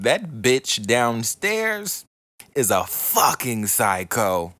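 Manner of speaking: loud, mock-appalled neighbor; hammed-up indignant quote; imitated outraged neighbor. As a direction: imitate a neighbor complaining loudly. Voicing the neighbor's complaint.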